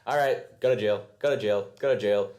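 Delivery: loud, brisk mock orders; mock-officious commands; Tarlok rounding people up. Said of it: "And he's like".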